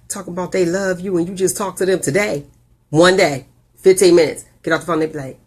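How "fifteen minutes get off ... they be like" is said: ordinary talk, nothing marked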